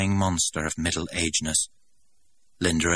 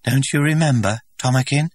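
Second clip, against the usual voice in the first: higher, louder, wavering plea; trembling voice; 8 semitones higher, 8 dB louder than usual. A narrator's reading. Linda, rejected, asking the director again, trembling. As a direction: hold back the tears as you ask. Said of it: "she repeated in a voice that trembled".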